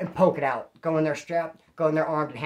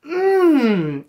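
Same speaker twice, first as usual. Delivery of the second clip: loud, long mock "mmm" moan; imitated eating noise; 7 semitones higher, 7 dB louder than usual. Mimicking the man moaning at the dinner table, loud drawn-out mock moan. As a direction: exaggerate a noisy eater's moan, higher and louder. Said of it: "he'd be all".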